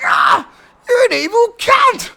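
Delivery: high, loud, slow wailing shout; a hammed-up anguished yell; anguished cry. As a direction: cry out as fleas land on you. Fleas thrown on him.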